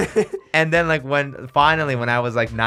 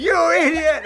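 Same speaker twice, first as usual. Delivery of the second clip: mock rage. Shook the kid, retold rage.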